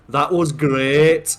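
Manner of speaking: loud, very slow Dudley impression; Dudley accent. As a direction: stretch it out in mock Dudley. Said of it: "she's from there".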